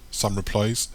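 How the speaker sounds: usual speaking voice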